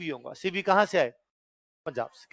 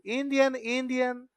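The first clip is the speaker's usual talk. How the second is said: excited call; 6 semitones higher, slower than usual. Retold or imitated. Russians recognising Indians.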